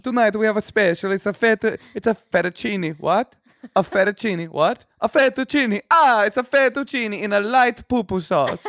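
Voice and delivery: high, theatrical Italian waiter; Italian accent. Shown as a demonstration. Italian waiter offering tonight's special, Italian accent. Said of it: "an Italian".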